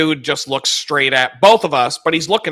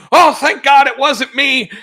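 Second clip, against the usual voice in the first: relieved coworker; 10 semitones higher, 5 dB louder than usual. Retold or imitated. Coworker relieved, walks away.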